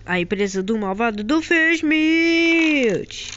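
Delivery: slow booming announcer. Acted. Announcing villain's company.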